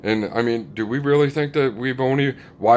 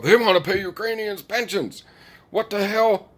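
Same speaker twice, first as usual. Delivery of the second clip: much higher mock outrage; hammed-up indignant news voice; mock outraged pundit; 9 semitones higher than usual. Fox News ranting about Ukrainian pensions, mock outrage. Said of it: "Fox News is going crazy".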